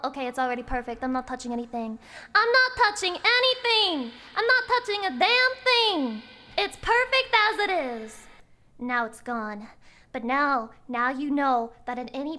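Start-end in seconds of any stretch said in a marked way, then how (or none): 2.3-4.1 higher, louder, insistent shout; loud exclaiming. Acted. Loud repeat of line 67. 4.3-8.3 loud exclaiming. Acted. Exclaims after calm line 67.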